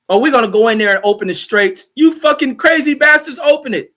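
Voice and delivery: high, loud mock outrage; mock angry yelling. Host mocks Trump's threat over the straits, angry yell. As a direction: yell the threat, pitch jumping way up.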